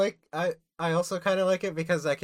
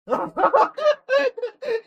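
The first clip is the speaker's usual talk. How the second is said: big high burst of laughter; shrieking laugh at the screen; loud laugh; 10 dB louder than usual. Real, not acted. Bursting out laughing at a game moment.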